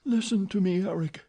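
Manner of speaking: a touch of a sigh, pleading; sighing plea. Acted. Christine sighing as she pleads with Eric. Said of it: "sighed the girl".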